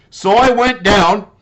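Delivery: ordinary delivery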